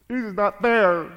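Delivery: whiny complaining to God, half-hammed; mock pouting whine. Sulking believer whines to God, mock pout. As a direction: pout it out like a sulky child. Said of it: "you're pouting to God and going".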